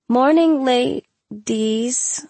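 high, drawn-out, faltering greeting; poorly concealed shock. A narrator's reading. Teacher greets two students after seeing the hair, shocked. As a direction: greet them while struggling to compose yourself. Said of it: "struggled to compose her shock".

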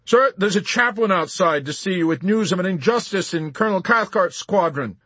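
corporal's loud report over the commotion; urgent corporal. A narrator's reading. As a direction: announce it urgently to a superior officer. Corporal reporting to panicking general.

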